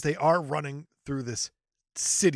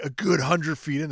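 ordinary delivery

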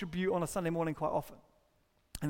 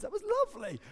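very high, put-on cooing; mock contentment; 13 semitones higher, faster than usual. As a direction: lift it into a high, self-satisfied singsong. Mocking nostalgic churchgoers, acted.

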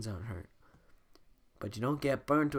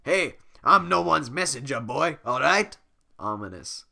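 annoyed ogre; 3 semitones higher, 9 dB louder than usual. Acted. Shrek irritated.

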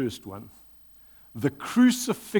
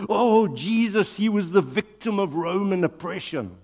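mocking quote; 6 semitones higher than usual. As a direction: parody the view he rejects. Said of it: "where the idea is that".

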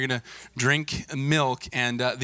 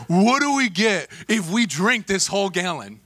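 higher, drawn-out kid impression; dopey teen boys; 6 semitones higher, 4 dB louder than usual. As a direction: drag it out like an eager teenage boy. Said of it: "That's the way they sounded".

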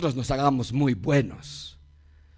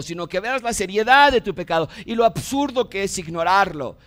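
ordinary delivery

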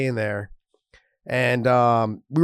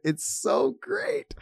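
usual speaking voice

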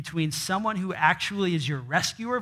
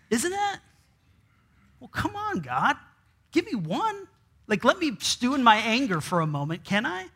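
squeaky high whiny protest to God; high-pitched mock grumble, begging to stay angry; mock exasperation; 6 semitones higher than usual. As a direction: whine at God, pretending to be put out.

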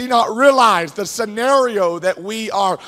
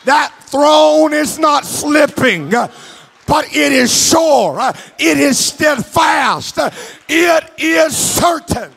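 higher, louder fervent preaching with sung tones; preaching at full force; 4 semitones higher, 4 dB louder than usual. Acted. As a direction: lift the congregation, preach it out.

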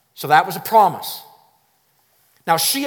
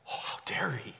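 whisper; 4 semitones lower, 13 dB quieter than usual. Pretend. Tempter's insinuating aside.

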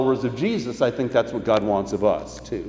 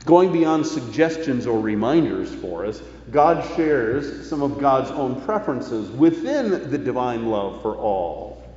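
ordinary talk, nothing marked

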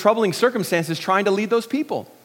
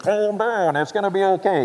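higher, muffled mask voice; imitated gas-mask voice; 3 semitones higher than usual. Masked paramedic to panicking wife, imitated muffled voice. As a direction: talk as if through a gas mask.

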